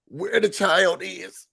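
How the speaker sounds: usual speaking voice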